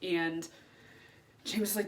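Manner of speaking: ordinary talk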